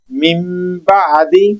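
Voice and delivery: من بعدی very slowly, nun sounded as m, mim ba'di; iqlab demo. Shown as a demonstration. Teacher repeating the example slowly for students. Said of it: "یہاں پر میم کی آواز پیدا ہوگی".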